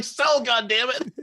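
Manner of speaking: high, drawn-out mock demand; mock wrestler's yell. Wrestler ordering a no-selling baby to sell, mock yell. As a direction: order it in a hammed-up strained cry.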